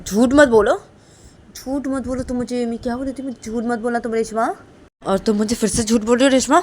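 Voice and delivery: heated, insistent telling-off; angry scolding. Acted. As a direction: scold her loudly for lying. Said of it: "तुम गुस्सा मत करो ना".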